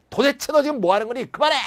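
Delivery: high, pressing scold; exasperated scolding. Shown as a demonstration. Teacher acting out scolding someone to stop. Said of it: "이렇게".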